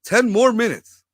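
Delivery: a hint of Obama's speech cadence; Obama impression. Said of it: "I've been working on my Obama voice".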